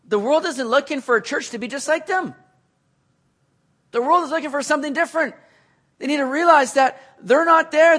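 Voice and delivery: high, loud, driving sermon build; rising pulpit fervor; preaching at full force. Acted. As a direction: preach it out to the whole room.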